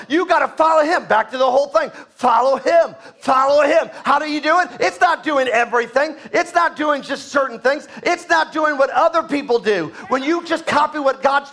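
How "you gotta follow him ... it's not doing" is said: high, loud preacher shout; full force preaching. Acted. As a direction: drive it home like a revival preacher. Preaching builds.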